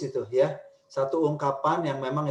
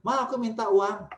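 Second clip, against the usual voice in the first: put-on a higher pleading kid; higher child asking mom for money; 8 semitones higher than usual. Shown as a demonstration. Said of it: "orang yang lemah lembut tuh bisa tegas".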